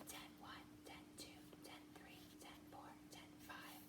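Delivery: whispered counting. Shown as a demonstration. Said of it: "whisper count as you do it".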